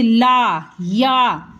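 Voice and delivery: "ला, या", each आ stretched long; drawn-out, open vowels. Shown as a demonstration. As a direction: say "ला, या" slowly with a wide-open mouth. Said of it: "खींच के बड़ा मुंह को-, करके बोलते हैं". ("ला, या" को बड़ा मुंह करके खींच के बोलें)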